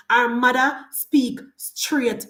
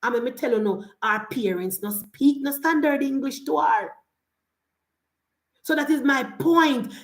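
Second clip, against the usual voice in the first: ordinary talk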